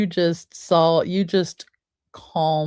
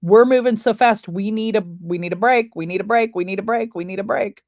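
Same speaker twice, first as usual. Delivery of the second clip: urgent body voice; 5 semitones higher, 4 dB louder, faster than usual. Acted. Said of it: "our body's way of saying".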